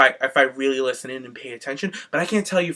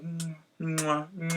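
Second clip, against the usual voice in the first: kiss sounds; 12 dB quieter, much slower than usual. Retold or imitated. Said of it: "He's like".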